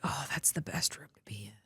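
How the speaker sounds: whispered, very soft retold remark; hushed aside. Student scrub tech asked whose room they were in, whispering.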